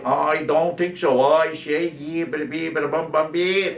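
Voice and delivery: high, loud mock Boston politician; Ted Kennedy. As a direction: boom out a hammed-up Boston parody. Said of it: "And Ted Kennedy will say".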